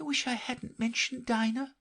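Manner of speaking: melancholy. A narrator's reading. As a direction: sigh it to herself. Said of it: "in a melancholy tone".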